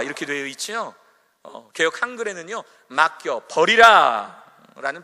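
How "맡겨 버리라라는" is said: 맡겨 버리라 drawn out slowly and loudly; stressed phrase. Shown as a demonstration. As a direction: stretch 맡겨 버리라 and hit it hard. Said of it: "표현으로 나옵니다".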